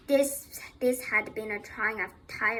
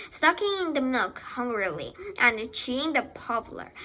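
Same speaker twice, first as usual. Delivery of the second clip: plain reading voice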